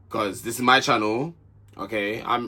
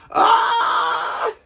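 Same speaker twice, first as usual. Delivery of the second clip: loud, high-pitched cry of disgust; exasperated scream; 4 dB louder than usual. Real, not acted. Vlogger recoiling at cheesy flirting, exasperated high scream.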